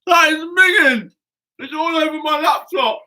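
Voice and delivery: talking through a mouthful of flour; words stuck in a mouthful of flour; mouth full of flour. Real, not acted. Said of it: "My teeth are stuck together".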